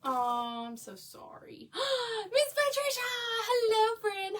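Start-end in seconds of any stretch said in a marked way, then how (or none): 1.7-2.3 delighted gasp. Real, not acted. Greeting a viewer who joined. 2.3-4.4 high, loud happy squeal of a name; light up as a favourite friend arrives; excited greeting. Real, not acted. Greeting a favourite viewer on the live, thrilled.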